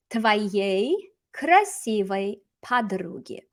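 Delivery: very slow, one word at a time; slow model reading. Shown as a demonstration. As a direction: spell out the phrase word by word. Teacher models phrase slowly, then repeats.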